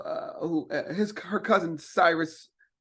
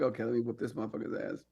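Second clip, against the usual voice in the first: muttered inner challenge; 7 semitones lower, 9 dB quieter, faster than usual. Retold or imitated. Inner thought on the golf course.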